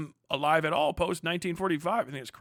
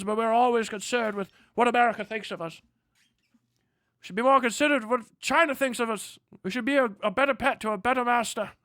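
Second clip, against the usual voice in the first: high-pitched, hammed-up Australian politician; mock Australian accent; 5 semitones higher than usual. Mimicking Australians on their own politics, in a hammed-up Australian accent. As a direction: imitate an Australian pleading in parliament, pitched high. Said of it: "when they talk about their politics".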